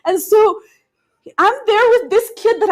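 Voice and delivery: helpless laughter at own folly; laugh-crying. Real, not acted. Laughing at her own story.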